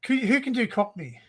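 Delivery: ordinary talk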